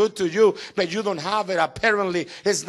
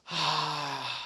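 weary sigh; 4 semitones lower, 8 dB quieter than usual. Retold or imitated. After prayer.

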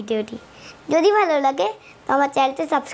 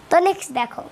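ordinary delivery

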